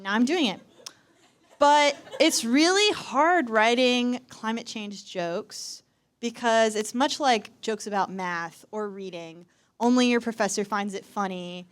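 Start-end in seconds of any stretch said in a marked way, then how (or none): none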